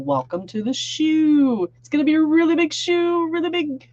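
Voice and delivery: a hammed-up variety show host; showman catchphrase. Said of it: "I love saying that".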